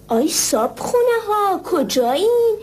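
loud, high monster bellow; div shouting. Acted. The div yelling at the sleeping sisters, a loud high bellow. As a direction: roar the demands like a greedy giant. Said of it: "داد زد".

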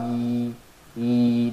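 ee held long from the middle of the mouth. Shown as a demonstration. Teacher demonstrating the long ya sound. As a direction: stretch the ee sound. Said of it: "that comes from the middle of the mouth".

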